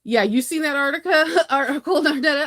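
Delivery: high, loud laughing question; laughing disbelief. Real, not acted. Asking Nardetta about the article, laughing loudly.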